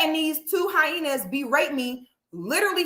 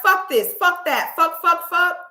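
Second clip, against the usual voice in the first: mock cursing; 5 semitones higher, 4 dB louder than usual. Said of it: "she was like".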